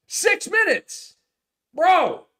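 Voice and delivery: high, slow, stretched disbelief; can't believe it's only six minutes; incredulous outburst. Real, not acted. Outraged at six minutes played.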